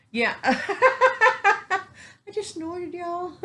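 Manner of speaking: real giggling, high and squeaky, words through laughter; cracking up mid-sentence; laughing. Real, not acted. Vlogger laughing at her own snort to viewers. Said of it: "Sorry, that tickled me".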